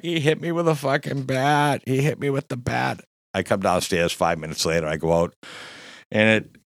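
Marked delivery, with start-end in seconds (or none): 0.0-3.0 high whiny mock complaint; imitating whining Jim. Bleeding Jim complaining to the cops, high whiny mock voice. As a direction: pitch way up, whine like a hurt drunk. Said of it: "He's like".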